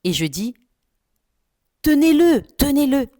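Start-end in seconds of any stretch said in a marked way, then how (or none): 1.8-3.1 high, loud panicked cry; shouting for help. Retold or imitated. In her dream, yelling at relatives to hold him, high shout. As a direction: yell at them to hold him.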